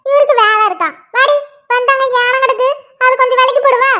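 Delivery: lightly voiced nagging mother; mother scolding. Mother finds her daughters sitting idle, nagging. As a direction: grumble at the lazy girls like their mum.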